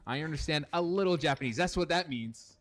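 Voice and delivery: ordinary delivery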